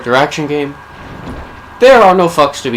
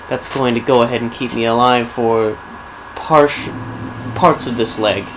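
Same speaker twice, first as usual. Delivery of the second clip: ordinary talk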